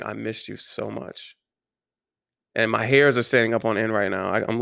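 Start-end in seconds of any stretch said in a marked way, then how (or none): 0.0-1.4 soft, slow and tearful. Retold or imitated. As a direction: say it softly, close to tears. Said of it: "crying and saying".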